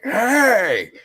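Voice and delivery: high, sharp irritated shout; annoyed yell. Retold or imitated. Annoyed at noises in the dark yard, high irritated yell. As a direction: yell it out, fed up now. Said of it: "I'm kinda getting aggravated".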